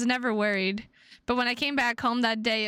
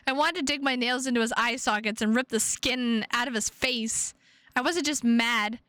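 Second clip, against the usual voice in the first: ordinary talk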